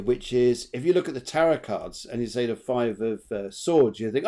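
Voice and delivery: ordinary delivery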